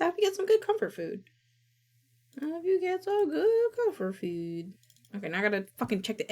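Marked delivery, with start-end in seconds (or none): 0.0-1.2 very high, fast sweet voice; cute high voice. Pretend. Streamer answers chat about snacking, high and cute. 2.4-4.7 high sweet voice. Pretend. Repeats chat line again.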